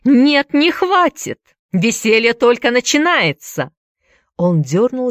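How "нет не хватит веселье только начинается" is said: very high, loud villainous glee; manic, taunting outburst; gleeful gloating. A narrator's reading. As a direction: crow that the fun has just begun, pitch leaping up. Villain gloats after taunting question.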